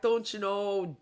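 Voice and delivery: put-on goofy Midwestern accent. Mocking the local accent, goofy Midwestern. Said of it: "stupid Midwestern goofy accent".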